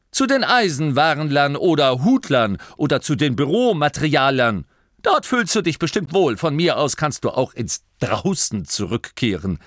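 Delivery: loud, dripping sarcasm; sarcastic duke sending him away. Acted. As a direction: dismiss him with biting irony. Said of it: "erklang unüberhörbarer Sarkasmus".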